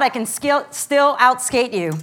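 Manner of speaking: usual speaking voice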